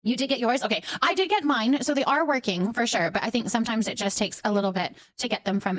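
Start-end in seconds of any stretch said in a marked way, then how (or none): none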